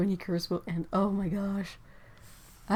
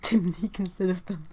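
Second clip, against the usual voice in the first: ordinary talk, nothing marked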